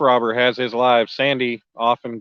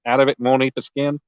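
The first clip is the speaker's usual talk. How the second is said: ordinary delivery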